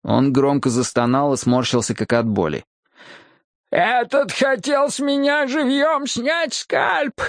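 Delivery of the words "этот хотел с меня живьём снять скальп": very high, drawn-out squeal; squeak. A narrator's reading. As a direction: squeak it out slowly, terribly high. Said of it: "пискнул он". (тонкий протяжный писк)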